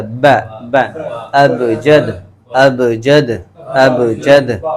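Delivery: با pressed lips then released with a bounce; qalqala ba demo. Shown as a demonstration. Teacher demonstrating qalqala on با for students. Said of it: "Arabic میں تھوڑا سا اس کو قلقلہ کر".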